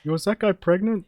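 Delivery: high, loud shocked blurt. Acted. NPC worker blurts out question.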